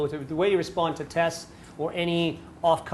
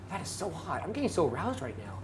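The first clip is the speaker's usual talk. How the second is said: much softer, teasing murmur; mock aroused murmur; 11 dB quieter than usual. Shown as a demonstration. Guy answering a girl's test with a soft mock aroused murmur. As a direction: lay it on softly.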